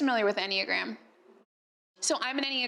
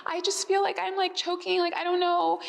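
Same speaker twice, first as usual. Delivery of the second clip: higher-pitched, mildly fearful confession; imitating yourself feeling choked up and scared; retold fear; 7 semitones higher than usual. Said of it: "feel the fear in my voice".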